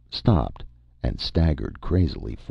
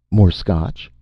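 higher, louder eager offer; crying out; 4 semitones higher, 6 dB louder than usual. A narrator's reading. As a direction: cry out the offer anxiously. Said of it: "Vern cried".

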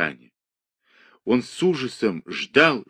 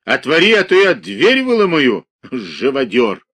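higher, louder raging threat; furious threat; 4 semitones higher, 6 dB louder than usual. A narrator's reading. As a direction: bellow it while pounding. Ivan threatens, pounding door.

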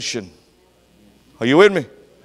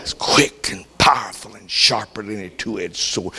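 whisper; 8 semitones lower, 4 dB quieter than usual. Acted. Preacher whispers scripture.

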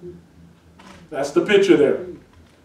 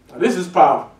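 ordinary talk

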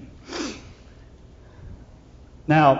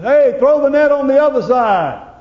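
higher and louder holler across the water; 10 semitones higher, 6 dB louder than usual. Retold or imitated. The Lord calling from the seashore to the fishermen, loud holler. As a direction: call out to the boat from the shore. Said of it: "he hollers out and he says".

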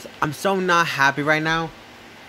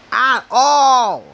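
louder, higher and slow; loud frustrated; 6 semitones higher, 6 dB louder than usual. Real, not acted. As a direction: stretch it out in frustration. Ranting about school.

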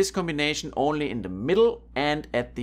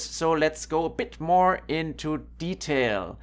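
usual speaking voice

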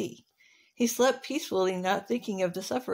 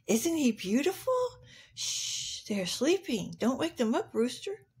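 softly voiced cow with a lilting rise; hushing cow; 4 semitones higher, 4 dB quieter than usual. Cow shushing the rooster so the sleepers don't wake, gentle. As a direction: hush the rooster gently, starting with a shh. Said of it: "Cow said".

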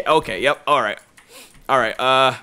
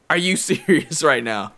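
usual speaking voice

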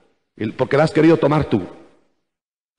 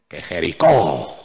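ominous drop; 13 semitones lower, 4 dB quieter than usual. Real, not acted. Ominous climax of a list.

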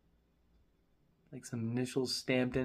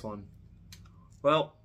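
talking around pipe stem; pipe in mouth. Real, not acted. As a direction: lips clenched on stem. Seen 0: Pipe stem in mouth until 3.3s; removed before later words.

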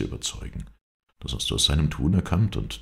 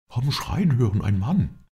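louder, higher-pitched urgent report; excited alarm; 9 semitones higher, 5 dB louder than usual. A narrator's reading. Servant tells the group of a cry, alarmed. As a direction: raise the alarm in agitation.

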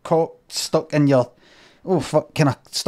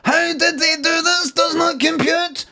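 glitching robot; 9 semitones higher than usual. Retold or imitated. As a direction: play a computer in meltdown. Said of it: "The computer's going all haywire".

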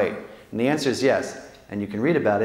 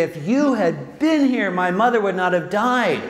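anguished reproach; 7 semitones higher than usual. Pretend. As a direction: let the grief and reproach break through. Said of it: "No, you're gonna say".

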